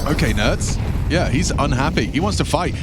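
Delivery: ordinary talk, nothing marked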